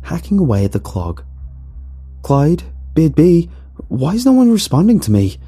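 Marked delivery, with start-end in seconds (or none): 2.2-5.4 high, frustrated yell. A narrator's reading. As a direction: yell to companions who won't answer. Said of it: "I yelled".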